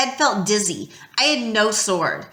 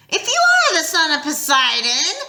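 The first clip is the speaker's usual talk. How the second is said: higher, louder hissed taunt; hissing monster; 7 semitones higher, 3 dB louder than usual. Acted. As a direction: hiss it as a scornful monster. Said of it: "Echidna hissed".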